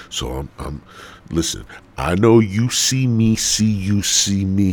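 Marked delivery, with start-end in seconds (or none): none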